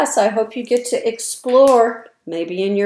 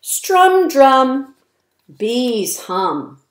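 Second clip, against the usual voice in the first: bouncy, sing-song rhyming read-aloud; reading to children. A narrator's reading. Reading rhymes to young children, lively sing-song. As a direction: punch each rhyme for little listeners.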